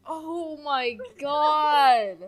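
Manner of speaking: loud, drawn-out oh my God; a stretched gasp of disbelief; horrified oh my God; shocked. Real, not acted. Shocked listener.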